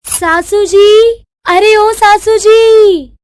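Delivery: high, drawn-out holler; long singsong yell on a high note; calling out. Acted. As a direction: summon someone from another room. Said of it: "जो बिस्तर से चिल्ला रही है".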